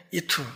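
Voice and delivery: 이투, the sh of 이쉬 turned into t; Galilean mispronunciation. Shown as a demonstration. Galilean saying man without realizing it, Galilean pronunciation. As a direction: slip into 이투 with a t sound. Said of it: "자기도 모르게".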